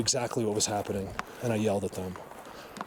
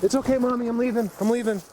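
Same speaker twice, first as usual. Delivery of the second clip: much higher, gentle coaxing voice; soothing mother deer; 11 semitones higher, 3 dB louder than usual. Real, not acted. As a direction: reassure the doe sweetly and high.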